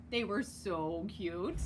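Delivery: ordinary delivery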